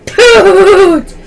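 high, loud goofy bursts; playful yell. Pretend. Playfully shouting the phrase.

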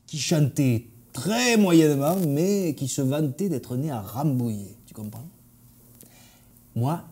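usual speaking voice

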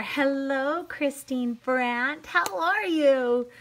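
high, long drawn-out hello; stretched-out, rising singsong; singsong greeting. Real, not acted. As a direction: sing out a slow, delighted welcome. Singsong greeting to viewer joining live.

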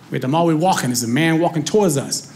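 ordinary delivery

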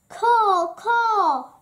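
two loud, high caws; croaking crow. A narrator's reading. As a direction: call out like a crow. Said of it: "he croaked".

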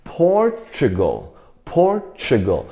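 Portugal slowly, the tu said as "chu"; ch sound for T. Shown as a demonstration. Teacher has the class read Portugal, slow, T as ch. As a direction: draw out Portugal as Por-chu-gal. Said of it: "你看这边T的音".

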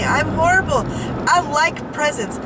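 ordinary delivery

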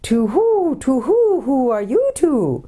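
high, drawn-out owl hoots; hooting owl. A narrator's reading. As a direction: hoot the words like an owl. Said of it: "said in a soft, hooting kind of voice".

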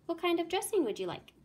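high, fast excited repeat; excited realisation. Acted. Shekel repeating Sally's question, excited and fast. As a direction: seize on the idea.

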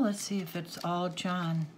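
normal talking voice

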